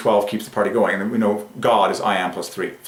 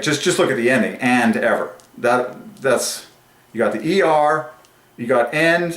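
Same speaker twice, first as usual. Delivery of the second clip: ordinary delivery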